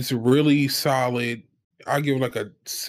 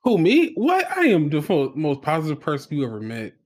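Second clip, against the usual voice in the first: mock offended; 2 semitones higher, 4 dB louder than usual. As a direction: act scandalised by the accusation. Mock outrage at being called negative.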